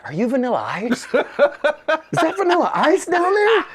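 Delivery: high, slow, laughing amazement; laughing cop. Retold or imitated. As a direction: cop cracking up at who it is. Cop recognizing him, laughing.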